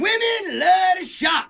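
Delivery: hammed-up comic yell; a friend showing off a quick impression; comedian impression. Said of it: "Can I do one?".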